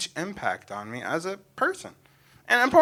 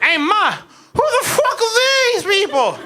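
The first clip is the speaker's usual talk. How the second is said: put-on high, loud little-kid demand; shrill childish outrage; indignant kid; 12 semitones higher, 3 dB louder than usual. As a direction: ask like a jealous five-year-old. Five-year-old asking his mom.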